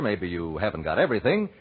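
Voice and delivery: normal talking voice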